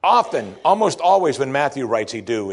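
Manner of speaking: ordinary talk, nothing marked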